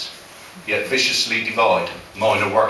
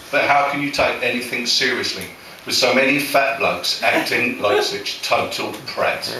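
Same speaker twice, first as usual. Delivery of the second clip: ordinary talk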